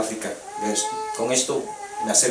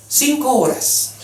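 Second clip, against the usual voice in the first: dry scolding; 12 semitones higher, 4 dB louder, much slower than usual. Retold or imitated. Widow scolds risen husband.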